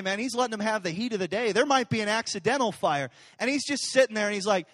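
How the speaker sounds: ordinary talk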